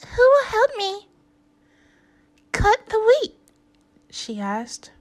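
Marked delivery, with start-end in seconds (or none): 0.1-3.4 high, loud excited hen. Acted. The excited hen asking animals for help. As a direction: cluck it out eagerly.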